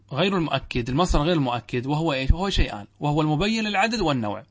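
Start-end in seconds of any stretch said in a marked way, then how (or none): none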